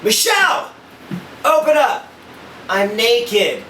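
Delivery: high, loud shout at the door; husband shouting at hotel door; yelling through door. Retold or imitated. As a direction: bang on the door and holler. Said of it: "so like".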